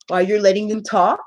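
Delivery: usual speaking voice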